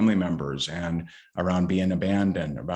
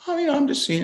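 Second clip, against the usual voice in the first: much higher, innocent-sounding wife voice; imitating wife; 4 dB louder, much faster than usual. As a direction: play the wife, airy and offhand. Wife voiced in funny story.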